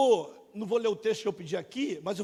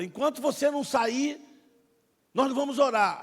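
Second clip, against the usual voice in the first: higher, louder, pressing demand; loud preacher's ultimatum; 4 semitones higher, 7 dB louder than usual. Retold or imitated. Pastor calling the last person forward, loud insistence. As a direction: push the one holdout to come forward. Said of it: "esse cara começou a falar".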